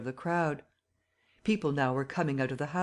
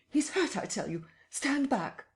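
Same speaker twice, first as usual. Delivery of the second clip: high, urgent call; muffled urgent order; urgent cry; 10 semitones higher than usual. A narrator's reading. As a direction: push the crowd back. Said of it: "cried the muffled voice of Kemp".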